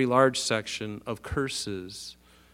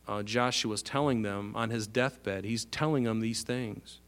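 ordinary delivery

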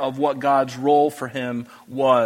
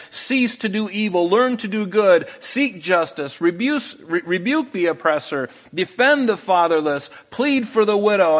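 raised pitch, forceful reading of God's rebuke; proclaiming scripture; 4 semitones higher than usual. Acted. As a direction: declaim it like the voice of God. Reads God's rebuke aloud.

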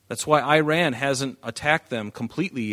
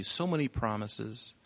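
ordinary delivery

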